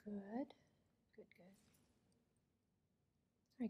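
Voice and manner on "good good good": soft whispered praise; whispering. Real, not acted. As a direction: breathe the words, barely voiced. Soft exam asides to patient.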